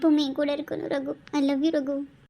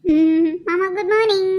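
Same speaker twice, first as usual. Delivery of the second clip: high, sing-song and loud good morning; coy morning greeting; 5 semitones higher, 6 dB louder, slower than usual. Acted. Cousin teasing the startled man awake, high and sing-song. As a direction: tease him sweetly awake.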